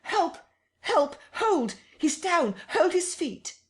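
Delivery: very high, loud cries for help; desperate shrieks; screaming. A narrator's reading. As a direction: scream for help pinning him down. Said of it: "screamed Kemp".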